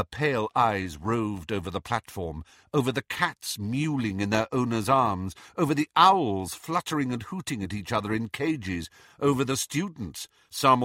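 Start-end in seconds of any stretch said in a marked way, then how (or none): none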